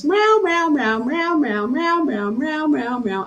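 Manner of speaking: high imitated cat meows, rising and falling; cat meowing. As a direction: meow like a chatty cat telling stories. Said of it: "she goes".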